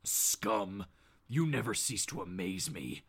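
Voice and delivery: very low, hushed contempt; deep, quiet menace up close; Batman. Acted. The vigilante leaning in close to Bob, low and contemptuous. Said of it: "leans in closer to Bob here and goes".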